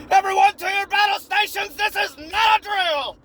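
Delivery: high, loud commander shout; battle station alarm call; panicked yell. Acted. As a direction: bark orders like a frantic officer. Brain cells yelling.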